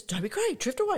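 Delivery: retold fan's excitement, rising high at the end; mildly excited fan. Lou naming his idol's song to his friend, mildly excited. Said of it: "he's getting all excited".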